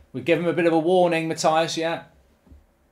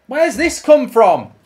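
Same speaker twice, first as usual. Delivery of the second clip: loud, slow, high exasperated yell; furious; 8 semitones higher, 12 dB louder, slower than usual. Real, not acted. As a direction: demand an answer in rage. Angry at his team's play.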